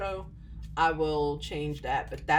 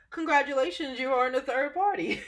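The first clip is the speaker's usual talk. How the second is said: laughing; 7 semitones higher than usual. Real, not acted. Laughing repeat to viewer.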